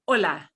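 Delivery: Hola said as "OH-la", no H; silent H. Shown as a demonstration. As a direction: drop the H in Hola. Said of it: "here's a silent H right away".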